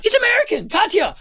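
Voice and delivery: thrilled, laughing reply to a shushing friend; giddy excitement. Retold or imitated. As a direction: gush with disbelief to your friend. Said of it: "It's like".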